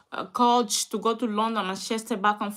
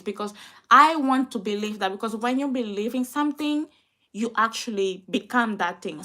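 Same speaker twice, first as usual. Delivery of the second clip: ordinary delivery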